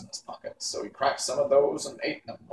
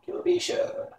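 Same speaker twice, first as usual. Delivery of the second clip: thoughtful witch. Acted. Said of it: "said Mombi thoughtfully".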